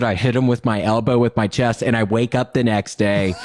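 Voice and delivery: ordinary delivery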